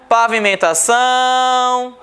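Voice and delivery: syllable-by-syllable "Pavimentação" ending on a sustained "ção"; stress on last syllable. Shown as a demonstration. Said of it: "Oxítona".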